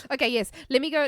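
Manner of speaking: ordinary talk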